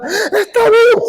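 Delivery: very high, drawn-out mock scream. Sister telling the family he is alive, high mock scream. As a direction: imitate an overjoyed shriek. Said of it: "Y se pone a gritar".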